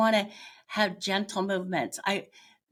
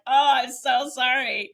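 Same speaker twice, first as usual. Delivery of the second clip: mock apology; 6 semitones higher, 5 dB louder than usual. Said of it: "And I'm like".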